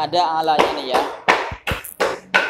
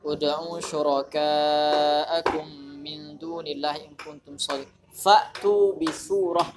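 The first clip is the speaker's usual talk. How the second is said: slow tajwid chant; understated melodic Quranic recital; Quran recitation; 4 dB quieter than usual. Shown as a demonstration. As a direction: recite the verse in measured, held syllables.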